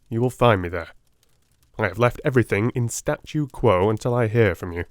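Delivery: ordinary delivery, nothing marked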